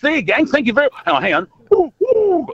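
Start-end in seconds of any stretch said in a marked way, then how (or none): 1.7-2.5 high loud owl hooting. Pretend. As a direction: hoot like an owl. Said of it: "That's my owl".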